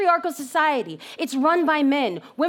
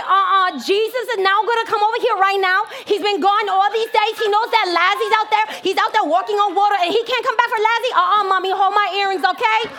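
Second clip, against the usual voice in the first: high, loud, indignant Martha with attitude; sassy attitude; 6 semitones higher, 5 dB louder than usual. Acted. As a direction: rattle it off like a sister spoiling for a fight. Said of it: "she got an attitude".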